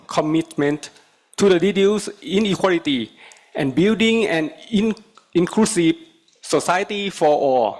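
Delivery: English with a Thai accent; formal speech, Thai accented English. Real, not acted. Thai delegate giving formal speech.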